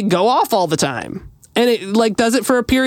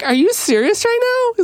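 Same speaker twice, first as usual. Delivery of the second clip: much higher, disbelieving squeal; amazed; 14 semitones higher than usual. Retold or imitated. She reacts to the fireman offering to fix the alarm, amazed. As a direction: sound stunned and thrilled. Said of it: "I'm like".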